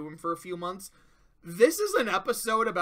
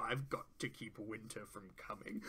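much lower and far softer, a mock-solemn joke voice; mock grave line; 8 semitones lower, 15 dB quieter than usual. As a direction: drop low and hush it like a dramatic trailer.

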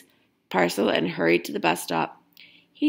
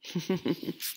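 soft laugh; 9 dB quieter than usual. Real, not acted. Reader laughs at story's end.

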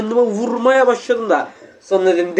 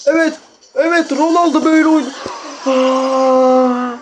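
higher, triumphant game cheer; excited cheer; 4 semitones higher, slower than usual. Real, not acted. Gamer bragging to viewers mid-match, higher triumphant cheer. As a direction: cheer your own great move.

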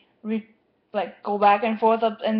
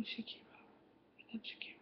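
whispered aside; 29 dB quieter, faster than usual. Real, not acted. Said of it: "distracted by TV".

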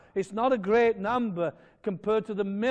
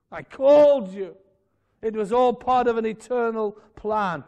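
climactic call; 5 semitones higher, 10 dB louder than usual. Acted. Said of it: "climactic preaching".

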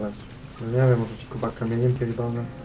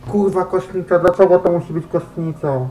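higher, louder dismayed complaint; dismayed outcry; 6 semitones higher, 8 dB louder than usual. Real, not acted. Gamer dreading the morgue level, loud dismayed protest.

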